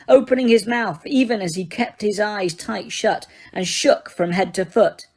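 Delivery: usual speaking voice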